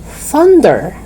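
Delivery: tongue out for the TH in "Thunder"; TH sound. Shown as a demonstration. Teacher modelling for repeat-after-me, "Thunder" with clear TH. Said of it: "So repeat after me".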